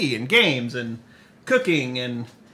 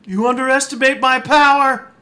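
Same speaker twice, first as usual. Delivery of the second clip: Anakin quote; 10 semitones higher, 6 dB louder than usual. Retold or imitated. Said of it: "Come on, Anakin".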